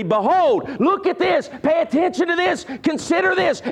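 rising emphatic preacher commands. Shown as a demonstration. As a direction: push every phrase up like a call to attention. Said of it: "wanting you to understand emphatically".

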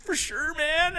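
high-pitched, hyped-up imitation; eager agreement. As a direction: agree eagerly as the happy bartender. Acted eager yes from bartender.

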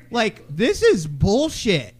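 ordinary delivery

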